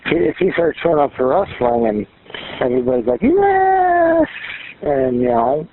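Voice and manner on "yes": very high, drawn-out cheer; imitated cheer. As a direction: mimic a crowd's long, high-pitched hurrah. Acts out everybody cheering at wrestling.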